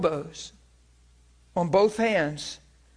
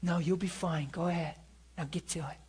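put-on soft, tender voice to a small child; reassuring a toddler, much softer; gentle encouragement; 11 dB quieter than usual. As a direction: coax a little kid softly. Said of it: "teaching a kid something and you pat 'em".

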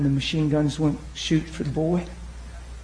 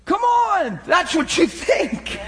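high, slow preacher's shout; high-pitched exhorting shout; impassioned challenge; 13 semitones higher, much slower than usual. Real, not acted. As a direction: cry out a challenge to the congregation. Preacher challenging the congregation at full force.

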